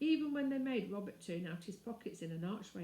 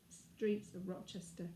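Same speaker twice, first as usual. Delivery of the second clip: ordinary delivery, nothing marked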